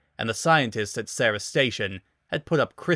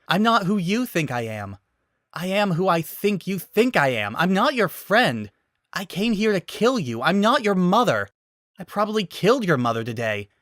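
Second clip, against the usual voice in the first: building angry rant; 7 semitones higher than usual. Acted. Samus venting her rage at the baby Metroid. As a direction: let the anger build line by line.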